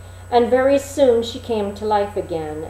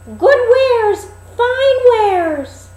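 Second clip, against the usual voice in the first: put-on very high, loud, slow old peddler call; a disguised queen hawking like an old crone; peddler woman crying wares; 8 dB louder, much slower than usual. Queen as old peddler calling at the dwarfs' door.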